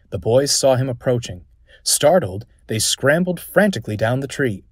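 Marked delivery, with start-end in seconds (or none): none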